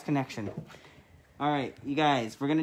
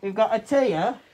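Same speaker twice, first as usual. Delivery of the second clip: high, loud mock-British introduction; hammed-up British accent, pitched up; attempted British accent; 7 semitones higher, 7 dB louder than usual. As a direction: announce the cat in over-the-top British. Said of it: "that accent you're doing".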